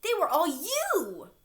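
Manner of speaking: high, loud shocked outburst; shocked exclaim. A narrator's reading. Alexander realizing the blob was every monster, shocked shout. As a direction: blurt it out in disbelief. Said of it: "Alexander gasped".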